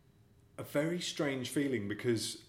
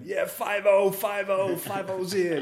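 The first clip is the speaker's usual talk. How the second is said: louder and higher teasing shout; mock call-out; 9 semitones higher, 8 dB louder, slower than usual. Lads outside the off licence teasing the TV cop, calling out. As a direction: call out to the cop across the street. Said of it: "there were all these guys outside going".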